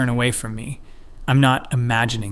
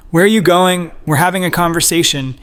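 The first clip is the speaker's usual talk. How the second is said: loud, high and menacing; aggressive shout; 6 semitones higher, 7 dB louder than usual. A narrator's reading. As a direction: bark it at her to stop her. Harasser blocks her path.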